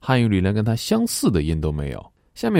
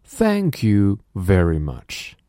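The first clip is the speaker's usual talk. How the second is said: 1 slow repeat; much slower than usual. Shown as a demonstration. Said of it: "Now repeat after me".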